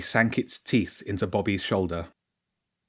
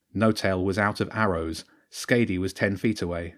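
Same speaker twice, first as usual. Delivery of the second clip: ordinary delivery, nothing marked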